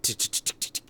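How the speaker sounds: soft voiceless tick tick of a clock; whispered clock ticking. Retold or imitated. Waiting out the coworker, whispered ticking. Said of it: "I'm like".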